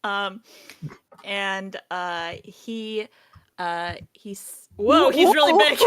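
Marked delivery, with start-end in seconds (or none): 4.8-5.9 laughing surprised exclamation, louder and higher. Real, not acted. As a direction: burst out laughing mid-sentence. GM laughing at figure.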